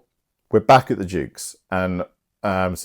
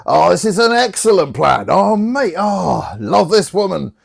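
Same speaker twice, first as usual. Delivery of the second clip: high, loud gleeful knight; delighted Sir Toby; 11 semitones higher, 5 dB louder than usual. Acted. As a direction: cheer the plan like a thrilled drunk.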